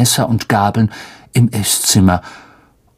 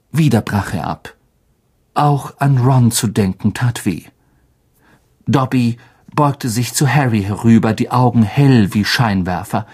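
ordinary delivery, nothing marked

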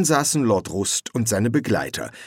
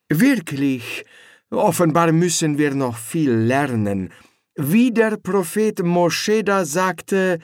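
higher, slow courtly irony; mock-polite prince; 9 semitones higher, 3 dB louder, slower than usual. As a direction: politely needle the lord.

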